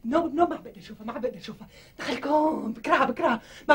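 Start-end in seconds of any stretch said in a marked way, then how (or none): 0.0-1.7 soft, lower whimpered repetition; whimpering. Acted. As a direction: moan it under your breath, pleading. Wife moans she can't bear her.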